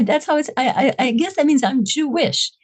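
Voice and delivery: ordinary delivery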